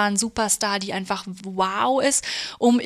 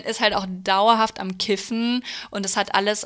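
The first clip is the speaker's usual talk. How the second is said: usual speaking voice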